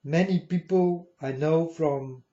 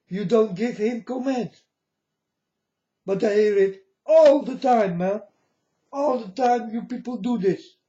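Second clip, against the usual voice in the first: ordinary talk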